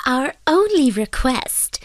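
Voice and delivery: high, slow fairy voice; little man. A narrator's reading. Fairy names his price to the fisherman, little-man voice. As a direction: pronounce it slowly as the little man. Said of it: "said the little man".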